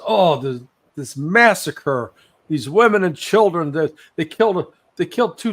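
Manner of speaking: retelling a distraught source's outburst; upset informant. Retold or imitated. Informant upset recounting massacre.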